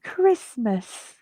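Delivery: soft, lightly voiced little boy; a small sick boy saying it gently; Tiny Tim voice. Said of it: "Was that a good Tiny Tim?".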